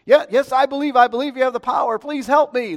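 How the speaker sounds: high, loud, hurried mock begging; frantic pleading. Hiker begs the voice for rescue, frantic. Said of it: "Help me, somebody out there".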